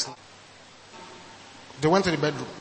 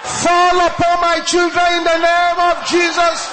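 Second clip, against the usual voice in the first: shouted prayer; 11 semitones higher, 9 dB louder than usual. Real, not acted. Pastor leading the congregation in a loud prayer, shouting. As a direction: cry it out to heaven at the top of the lungs. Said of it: "shout it loud and clear".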